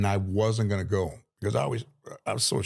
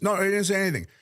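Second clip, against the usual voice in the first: higher mock Jack, brushing it off; lightly imitated Jack waving it away; Jack's voice; 9 semitones higher, much faster than usual. Jack tells Pesci nothing was said, higher imitation. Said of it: "those voices".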